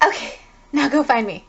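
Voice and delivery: lightly acted giddy excitement; excited and happy. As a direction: play at being thrilled by the game. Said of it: "gets so excited and so happy".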